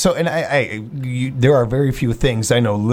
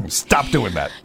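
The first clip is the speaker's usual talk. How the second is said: usual speaking voice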